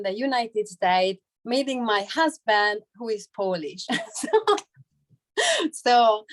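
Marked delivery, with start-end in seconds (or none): none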